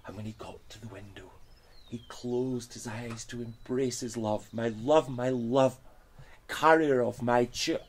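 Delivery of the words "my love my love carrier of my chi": passionate call. Acted. Knight greets love passionately.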